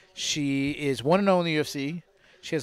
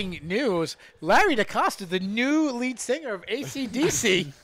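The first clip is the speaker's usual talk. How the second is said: mock announcer; 6 semitones higher, slower than usual. Host announces Larry as singer.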